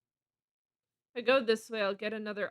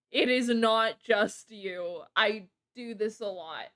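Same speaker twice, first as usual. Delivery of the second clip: ordinary delivery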